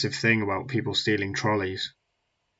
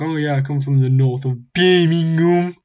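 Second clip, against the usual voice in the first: loud, higher mock Birmingham accent; 4 semitones higher, 5 dB louder than usual. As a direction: tease with a broad Birmingham accent. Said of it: "start talking with a Birmingham accent".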